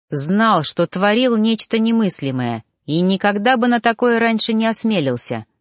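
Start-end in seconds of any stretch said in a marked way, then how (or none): none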